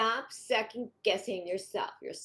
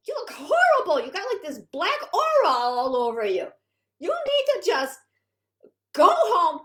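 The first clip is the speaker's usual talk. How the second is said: much higher, louder exasperated scolding; scolding friend; 8 semitones higher, 7 dB louder than usual. Retold or imitated. Friend scolding moping heroine, loud high exasperated nagging. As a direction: nag her to snap out of it, loud and shrill-pitched. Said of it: "her friend says to her".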